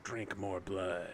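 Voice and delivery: low, soft, slow demonic farewell; infernal messenger signing off. Acted. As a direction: intone it quietly and slowly before hanging up. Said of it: "as he's about to hang up the portal, he's like".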